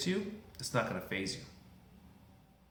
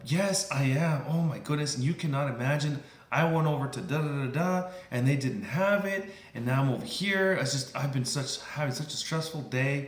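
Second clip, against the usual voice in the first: higher, worked-up outpouring; stressed venting; 4 semitones higher, 3 dB louder than usual. Acted. Customer vents to a sympathetic clerk about the day, worked up. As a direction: mimic a frazzled customer unloading.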